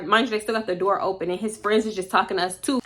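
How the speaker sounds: usual speaking voice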